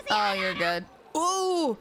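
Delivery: ordinary delivery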